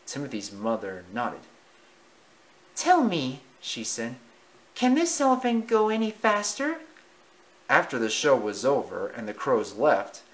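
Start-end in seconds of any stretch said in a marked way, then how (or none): 2.8-3.4 mother mouse voice. Acted. 4.8-6.8 high, thrilled mouse mother; eager mother mouse. Acted. Mother voiced.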